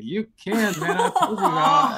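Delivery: high, loud retelling of a dad's reassurance; animated, laughing re-enactment; retold emotional reply. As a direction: exclaim it to a tearful son through laughter. Acted reply to crying son.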